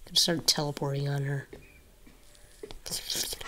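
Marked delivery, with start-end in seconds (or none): 2.6-3.4 breathy hushed word; whisper. Real, not acted. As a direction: barely voice it. Hiding from monster in game.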